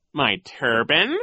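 high, slow questioning echo; put-on voice, taken aback; Coach Genghis. A narrator's reading. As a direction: query it, drawn out and lifted. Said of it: "Coach Genghis said".

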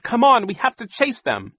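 put-on high, loud, urgent girl's voice; shouting. A narrator's reading. Violet urges a chase after villains, shouting. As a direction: urge the others into a chase. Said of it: "Violet shouted".

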